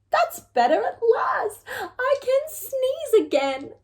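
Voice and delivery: high, cheerful relief; an old woman glad of space; relieved old lady. Acted. Lady relieved as the hen flies out.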